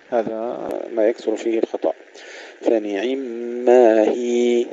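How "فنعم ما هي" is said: slow recitation, "هي" held as a long ee; two-count long ya. Shown as a demonstration. Teacher gives the correct stop after the mistakes, lengthened ya. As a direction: stretch the ي of "هي" smoothly to the stop. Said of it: "نمد الياء حركتين".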